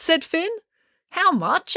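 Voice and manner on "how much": high-pitched, interested question; curious. A narrator's reading. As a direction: perk up with sudden curiosity. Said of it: "asked April curiously".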